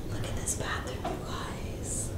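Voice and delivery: hushed whisper of amazement; awed whisper. Real, not acted. Showing viewers the hotel bathroom, awed whisper. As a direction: breathe it out in awe.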